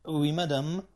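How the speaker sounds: courteous French croupier; polite confirmation. A narrator's reading. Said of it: "bestätigte der Croupier höflich".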